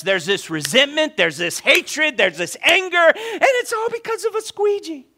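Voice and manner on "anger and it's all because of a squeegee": exasperated, comically high-pitched build; incredulous climax. Acted. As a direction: voice shoots up very high. Absurd climax of the bitterness list.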